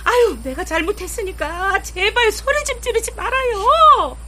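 high, crying plea to stop shouting; begging him to calm down, near tears; tearful pleading. Acted. Wife pleads during quarrel. (울먹이며 애원하는 말투)

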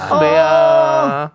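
slow, high, loud drawn-out call; drama catchphrase impression. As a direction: stretch the name out loud. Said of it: "여인천하".

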